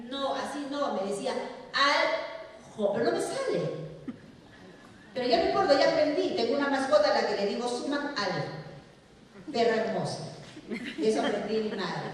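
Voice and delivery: ordinary talk, nothing marked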